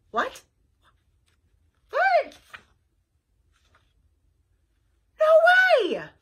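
rising to a high loud yelp; utterly stunned; shocked detective. Acted. Flytrap stunned by the sloth's confession, high yelp.

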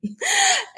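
quick high burst of laughter. Real, not acted. Host laughing after the spelling joke, quick high laugh.

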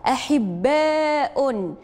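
very slow, stretched syllables; أحباء read very slowly, every syllable held; drawn-out model word. Shown as a demonstration. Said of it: "انتقلوا الكلمة الموالية".

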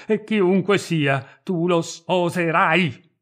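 ordine urlato, forte e lento; gridato. A narrator's reading. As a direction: impone il matrimonio gridando. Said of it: "gridò il Bandi". (il fratello ordina alla sorella, urlando)

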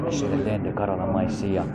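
soft, slow murmured tongues; quiet glossolalia under the breath; speaking in tongues. Real, not acted. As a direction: let the syllables flow low and hushed. Praying in tongues after amen.

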